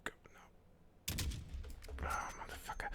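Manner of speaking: frustrated whisper. Real, not acted. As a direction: hiss it without voice. Shot misses while aiming.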